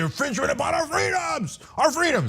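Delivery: high, hollered Southern caricature with stretched vowels; mock Southern accent. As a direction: wail it like an indignant mock Southerner. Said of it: "you just get up in the Southern accent".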